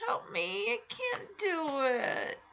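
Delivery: soft, slow little plea; a hushed, helpless ask; pleading child. Retold or imitated. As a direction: beg quietly for help. Michelle pleads with Jesse.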